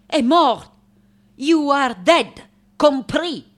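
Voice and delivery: loud, high declaration; emphatic insistence. Acted. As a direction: hammer the news home. Insists to artist she is dead.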